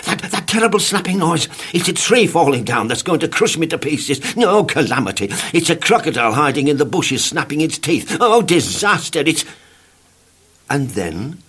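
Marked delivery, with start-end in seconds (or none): 0.1-9.4 panicky rambling. Acted. Mr Jelly panics at twig.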